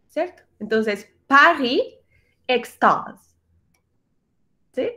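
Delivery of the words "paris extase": "Paris, extase" in French, A leaning toward O; French back A. Shown as a demonstration. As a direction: model "Paris" and "extase" separately with an O-tinged A. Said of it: "tiene un poquitico de O". ("Paris, extase" con la A hacia la O)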